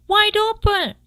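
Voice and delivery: very high, loud yell. A narrator's reading. Wife yelling at the fox, very high and loud. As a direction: shriek the order. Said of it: "yelled his wife".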